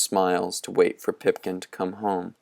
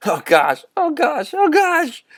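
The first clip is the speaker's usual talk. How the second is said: climbing much higher, louder happy yell; joyful yelling; 5 dB louder than usual. A narrator's reading. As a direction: leap off the porch shouting with relief. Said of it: "Tom leapt off the porch yelling".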